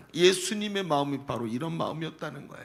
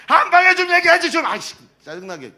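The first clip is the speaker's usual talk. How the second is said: sudden shout, much higher and louder, fed up; exasperated outburst; 10 semitones higher, 9 dB louder than usual. Acted. Preacher voicing an impatient retort in the story, exasperated shout. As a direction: blurt it out like you've lost all patience. Abraham's haggling voiced as exasperation.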